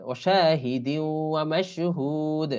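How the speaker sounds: ওয়াশাহিদি flowing into ওয়া on a long nasal glide; slow recitation of ওয়াশাহিদি ওয়া মাশহুদ; merged with ghunnah. Shown as a demonstration. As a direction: draw out মাশহুদ like a Quran teacher. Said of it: "এভাবে মিলিয়ে ইদগাম করে".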